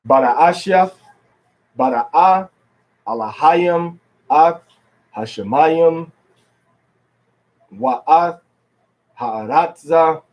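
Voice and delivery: word-by-word "Bada Ashiath", each stress placed firmly; "Ha'aratza" set apart by pauses, teacher modelling stress; demonstration reading. Shown as a demonstration. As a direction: read "Alahayim, Hashemayim" deliberately, leaning on next-to-last syllables. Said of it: "So I'm gonna read it".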